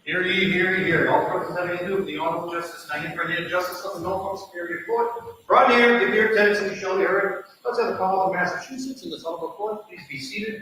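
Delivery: formal court crier call; stern archaic proclamation. Real, not acted. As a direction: ring out the court opening. Court officer opens the session.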